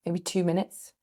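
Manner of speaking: ordinary talk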